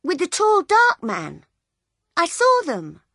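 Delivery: put-on piping up like a little girl. Little girl telling Bill, high child voice. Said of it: "she said".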